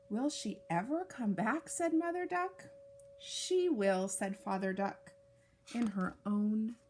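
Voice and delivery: gentle storybook read-aloud; reading to children. Acted. Reads picture book to children.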